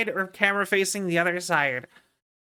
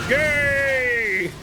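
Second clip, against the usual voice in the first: imitated ad yell; 4 semitones higher, 3 dB louder than usual. Streamer imitating the mobile game ad king, a louder mock yell. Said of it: "he just yells".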